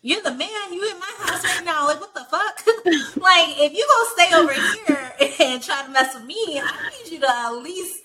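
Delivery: high-pitched retold scolding broken by laughter; angry, laughing rant. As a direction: scold him while cracking up. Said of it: "I'm angry at him".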